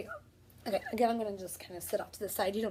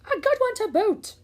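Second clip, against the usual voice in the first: much higher cartoon pirate chorus; pirate crew; 11 semitones higher, 6 dB louder than usual. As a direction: chime in like a crewman. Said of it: "the others agreed".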